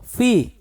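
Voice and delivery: fi said high with the mouth dropping. Shown as a demonstration. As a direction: spread the lips for fi as the jaw lowers. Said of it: "Kita baca".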